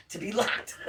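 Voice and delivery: whispered To be locked through a giggle; whispered laugh. Real, not acted. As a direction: breathe it out, amused. Laughing aside after retort.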